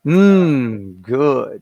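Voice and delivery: loud, drawn-out yum of pleasure; loud satisfied mm good; savoring mm. Real, not acted. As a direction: relish the taste out loud. Savoring wife's lasagna.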